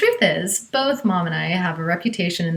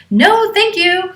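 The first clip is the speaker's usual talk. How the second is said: much higher, louder, slow, dead-firm no; firm refusal; 12 semitones higher, 6 dB louder, slower than usual. Retold or imitated. Mother refusing the stranger at the door, firm and loud. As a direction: refuse him firmly and slowly. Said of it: "says in a very firm voice".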